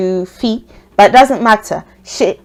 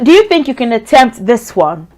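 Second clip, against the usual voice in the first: ordinary delivery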